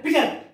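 PICTURE quick as "pitcher", k dropped; run together without stop. Shown as a demonstration. Teacher showing pupils what they don't say, run together. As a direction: slur PICTURE into two joined syllables, "pi-cher". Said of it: "You are not telling like that".

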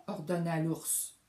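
plain reading voice

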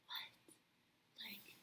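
hushed, disbelieving whisper to herself; stunned whisper. Retold or imitated. Overhearing the camper, a stunned whisper. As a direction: breathe it out in shock, barely voiced.

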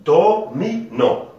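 DO, MI, NON as separate high, loud beats; syllable by syllable. Shown as a demonstration. Teacher showing pupils syllable division, "do, mi, non" split syllable by syllable. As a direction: break it into "do", "mi", "non" with pauses. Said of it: "c'est le découpage syllabique".

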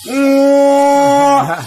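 ordinary talk, nothing marked

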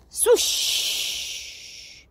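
long hissing whoosh of flame; imitated swoosh. A narrator's reading. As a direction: let out a rushing flame noise.

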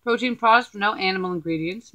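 speaking between bites; chewing while talking. Real, not acted. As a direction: mouth partly full. Seen 2: bit food at -3s; mouth still working while talking, then hidden.

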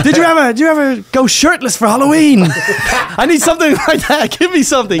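high pleading voice. Retold or imitated.

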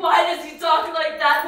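loud, high despairing cry; anguished shout about his words. Acted. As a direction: hurl the question out. Distraught outburst.

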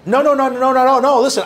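rapid-fire loud, high protest; emphatic objection. Real, not acted. Speaker cutting off a questioner's worry about pessimism, emphatic objection.

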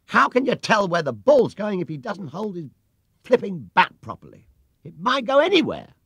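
high, loud exasperated complaint; indignant protest. A narrator's reading. Smasher Knowles protests to the umpire, loud and exasperated. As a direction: rant at the unfairness.

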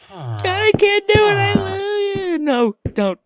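high, loud mock crying; hammed-up sobbing plea. Telling villager Jerry goodbye, mock sobbing high. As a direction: overplay the tearful goodbye.

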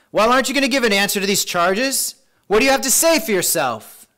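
high, loud interrogation; demanding high priest. A narrator's reading. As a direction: challenge the accused to answer. High priest interrogates Jesus in reading.

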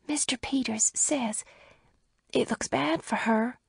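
hushed, unvoiced whisper. A narrator's reading. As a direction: lean in and whisper it. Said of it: "went on in a lowered voice".

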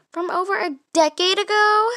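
high, loud incredulous question; stunned, loud protest; indignant disbelief. Acted. Defending friend, indignant.

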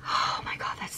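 voiceless, hushed "Oh my God"; whispered awe. Real, not acted. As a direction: breathe it out in rapture. Smelling again, breathy.

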